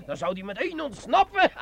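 ordinary delivery

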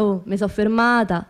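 normal talking voice

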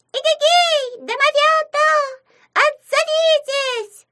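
very high, loud hallooing shout; calling out. A narrator's reading. Imp calling hidden house imps, shrieky halloo. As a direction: holler it long through cupped hands, squeaky. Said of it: "сложила ладошки рупором и закричала".

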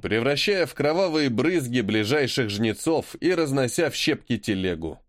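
ordinary delivery, nothing marked

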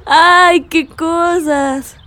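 a slow sigh of leftover laughter; laughing. Real, not acted. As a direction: come down from a laughing fit, drawing it out. Wiping tears after laughing. (suspiro lento después de reír)

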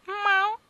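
high, loud mewing meow; sharp high cat cry. Acted. Cat meows.

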